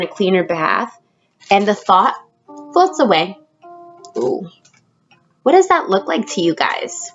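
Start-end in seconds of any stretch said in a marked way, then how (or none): none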